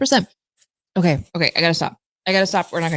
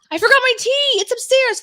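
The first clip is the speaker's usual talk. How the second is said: excited outburst. Real, not acted. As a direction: blurt it out in alarm. Sudden cry about forgotten tea.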